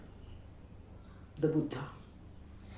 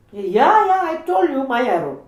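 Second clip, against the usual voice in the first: louder and higher, impatient; insistent cousin; 8 semitones higher, 10 dB louder than usual. Retold or imitated. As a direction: brush him off, loudly.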